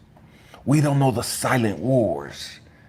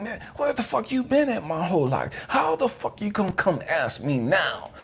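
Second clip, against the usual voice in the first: pissed at God; 10 semitones higher than usual. Retold or imitated. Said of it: "I'm pissed at God".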